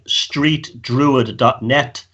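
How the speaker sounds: very slow, spelling it out; slow and clear. Shown as a demonstration. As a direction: say the web address slowly and deliberately. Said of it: "spelling out website slowly".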